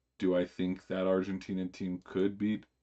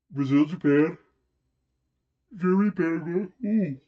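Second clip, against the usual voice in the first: higher, louder excited reading of matchups; excited listing; 9 semitones higher, 7 dB louder than usual. Real, not acted. Streamer reads the scoreboard to viewers, hyped and loud. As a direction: read the fixtures getting hyped.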